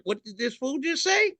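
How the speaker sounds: high, far-off voice for the piñata; the piñata hearing something outrageous; offended piñata. Retold or imitated. Piñata indignantly voiced, high.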